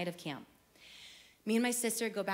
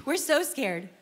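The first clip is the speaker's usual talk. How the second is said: ordinary talk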